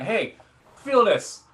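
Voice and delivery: high, drawn-out keen summons; eager hey. Retold or imitated. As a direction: invite them in like it's a treat. Doctor calls med students over.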